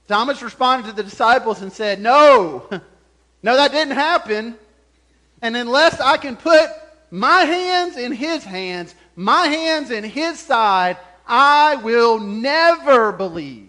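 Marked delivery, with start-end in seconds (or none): 2.0-13.7 loud, drawn-out defiance, rising in pitch; emphatic refusal. Retold or imitated. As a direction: act out a doubter's firm, emphatic no. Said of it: "He says it emphatically".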